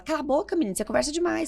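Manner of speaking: ton de réprimande, voix plus haute; gronderie imitée. Retold or imitated. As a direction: imite un parent qui fait taire sa fille. Mère excédée qui gronde.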